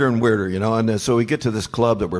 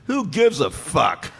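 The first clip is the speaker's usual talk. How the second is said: slow deadpan put-down; 6 semitones higher, slower than usual. Retold or imitated. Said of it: "And he goes".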